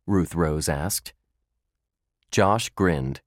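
plain reading voice

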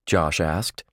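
usual speaking voice

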